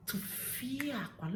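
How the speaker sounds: Tufiakwa slow, soft and high on "fia"; demonstrated exclamation. Shown as a demonstration. Naming what must not happen, Tufiakwa drawn out softly. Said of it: "You will refer it as".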